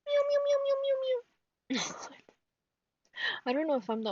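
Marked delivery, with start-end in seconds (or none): 0.0-1.0 very high squeaky meows at the cat; mock meowing. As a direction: meow like a kitten to call him.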